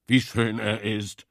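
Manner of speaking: a lightly voiced moaning boar; groaned. A narrator's reading. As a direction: sigh it out in hopeless admiration. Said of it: "stöhnte Wildschwein".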